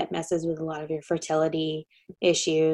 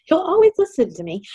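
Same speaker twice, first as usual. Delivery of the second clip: laughing; 10 semitones higher, 5 dB louder, faster than usual. Real, not acted. Joking about baby son.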